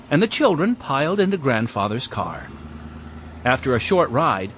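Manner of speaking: usual speaking voice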